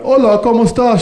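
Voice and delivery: high, loud cheesy tourist Spanish; overly cheerful gringo greeting; mock tourist. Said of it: "Walking around".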